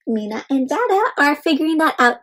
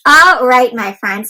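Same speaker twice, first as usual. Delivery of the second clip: usual speaking voice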